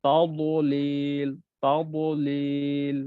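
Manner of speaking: তাবলিল very slow, lips rounded on the dad; rounded-mouth dad. Shown as a demonstration. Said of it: "মুখটা একটু গুল করে পড়তে হবে". (মুখ গোল করে খুব ধীরে তাবলিল)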